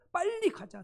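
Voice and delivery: high, loud rallying call; eager urging. Retold or imitated. Joshua and Caleb rallying Israel to the promised land, eager call.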